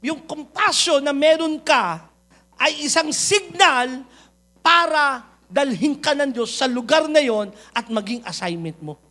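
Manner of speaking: slow, emphatic sermon proclamation; preaching. Acted. Pastor proclaiming to the church, slow and emphatic.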